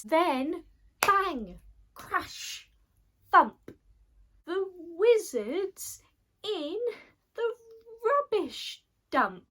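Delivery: high, loud, triumphant storytelling; big excited storybook climax; dramatic crash. A narrator's reading. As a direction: punch out each crash sound. Wizard crash-lands in the dump.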